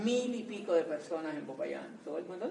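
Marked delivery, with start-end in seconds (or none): none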